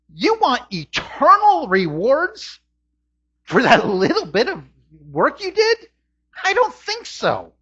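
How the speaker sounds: high-pitched scoffing disbelief; incredulous. Acted. Preacher scorning a demand for eternal reward, incredulous. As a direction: question it in rising disbelief, then dismiss it.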